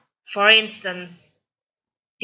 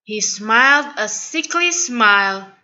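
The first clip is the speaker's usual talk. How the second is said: usual speaking voice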